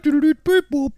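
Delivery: high, squeaky beeps; mock beeping noise. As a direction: beep it out in a high toy voice. Imitating a beeping sound.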